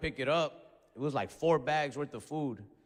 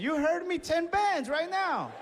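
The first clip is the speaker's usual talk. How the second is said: much higher, slow, hammed-up yell; mock furious order; 13 semitones higher, 3 dB louder, slower than usual. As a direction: stretch each word like a big-shot. Shouting his order.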